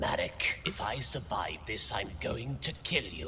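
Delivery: soft breathy threat to Marek; whispered threat. Acted. Bakura quietly threatening Marek. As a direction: hiss it under your breath.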